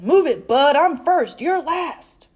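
loud, bossy truck voice; pushy shout. A narrator's reading. Grocery truck to little truck, pushy shout. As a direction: shout it to push ahead.

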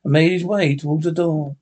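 ordinary delivery

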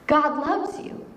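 ordinary talk

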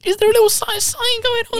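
very high, put-on questioning voice; mock suspicious query. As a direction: squeak it out as a hammed-up suspicion. Acting her suspicion of a lisp.

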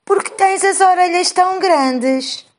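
put-on high, slow little girl voice; a small girl asking, pitched high. A narrator's reading. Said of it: "A menina pergunta à avó".

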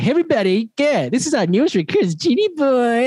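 high-pitched mock radio announcer introduction; hyped radio host. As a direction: announce the newcomer high, drawing out the name. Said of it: "I wanted to go on radio".

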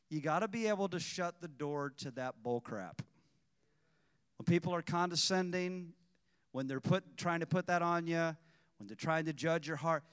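ordinary talk, nothing marked

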